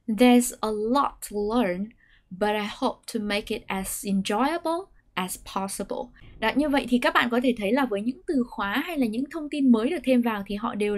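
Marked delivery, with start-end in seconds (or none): 0.1-6.2 slow model read, "enjoyable" stressed and lifted; staircase intonation. Shown as a demonstration. Teacher continues the slow model reading. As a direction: lift only the new words. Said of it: "Tiếp theo".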